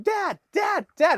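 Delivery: put-on high child's voice calling Dad; imitating child calling. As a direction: mimic the little boy tugging at his dad. Son calling while retold.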